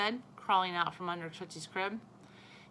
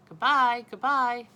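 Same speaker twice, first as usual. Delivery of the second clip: much louder gleeful shout; loud farewell call; 5 semitones higher, 11 dB louder, slower than usual. A narrator's reading. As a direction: yell goodbye with glee. Child cheering stamps goodbye.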